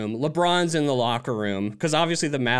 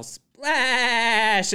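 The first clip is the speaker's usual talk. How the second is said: yelled name; 8 semitones higher, 4 dB louder than usual. Retold or imitated. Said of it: "you yell".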